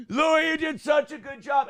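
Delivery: hammed-up sloppy drunk fan; imitating drunk friend. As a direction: drunk buddy calling after a comedian. Drunk buddy shouts at Louis.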